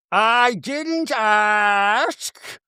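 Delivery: high, hugely drawn-out denial; mock denial. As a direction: stretch every word in theatrical refusal. Acted quarrel, Meredith denying.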